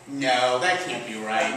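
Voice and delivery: low, doubtful imitation of a corrector; mock correction. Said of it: "She actually corrected me".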